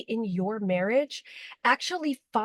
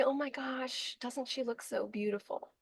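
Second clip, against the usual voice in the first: hushed admiring; 2 semitones higher, 11 dB quieter than usual. Pretend. Wife admiring daughter as bride.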